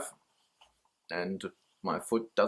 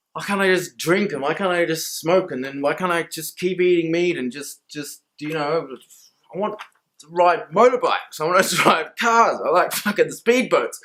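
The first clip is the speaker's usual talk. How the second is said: higher, louder, laughing through it; excited rant; 5 semitones higher, 5 dB louder than usual. Real, not acted. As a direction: let it build into a gleeful rant. Rant about wanting old pleasures.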